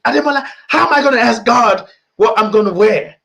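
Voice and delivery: put-on high, loud, incredulous Nigerian accent. Friend challenging speaker about asking God, incredulous Nigerian accent. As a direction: imitate a Nigerian friend's indignant outburst. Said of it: "I'm doing the accent".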